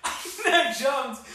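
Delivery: high, loud words through real laughter; laughing. Real, not acted. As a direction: laugh through it as you recall. Laughing while telling the escape.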